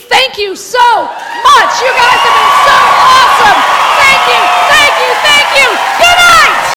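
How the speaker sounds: shouting thanks to the crowd, high; big show-closing thank you; loud sign-off. Real, not acted. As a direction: belt out the goodbye.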